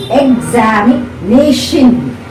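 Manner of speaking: examination slowed, leaning on NA: exami-NA-tion; stress on NA. Shown as a demonstration. Teacher showing a polysyllable word, examination stressed on NA. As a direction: stretch examination and push the syllable NA. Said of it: "N is stressed".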